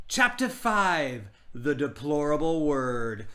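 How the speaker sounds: slow, grand chapter reading; story narration. Acted. Reading the chapter title aloud, slow and grand. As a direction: announce the chapter title slowly.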